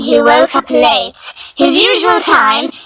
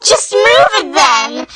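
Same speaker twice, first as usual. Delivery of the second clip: put-on high, slow childish suggestion; Patty's voice; 6 semitones higher than usual. Patty tells Kid to move the tree, childish. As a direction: suggest it sing-song like a child.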